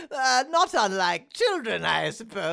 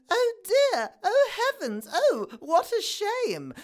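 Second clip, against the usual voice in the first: very high, dainty dismay; prim girl voice; 9 semitones higher than usual. Acted. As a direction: gasp it out like a proper girl. Nice girl quoted.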